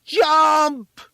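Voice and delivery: long, high, very loud shout; young voice shouting. A narrator's reading. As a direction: yell one word up to the roof. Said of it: "shouted a lone word to the boy".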